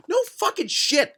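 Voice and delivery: high-pitched sarcastic outburst; exasperated yell. Acted. Reader answering Chris's Pixar observation, exasperated yell. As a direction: squawk it in disbelief.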